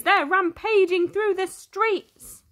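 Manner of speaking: panicked cry. Acted. The mayor warning of escaped lions, panicked. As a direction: sound the alarm. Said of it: "he cries".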